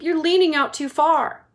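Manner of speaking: high, loud warning; father shouting a warning. Acted. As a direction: warn him he's too far out. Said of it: "shouted Mister Gloop".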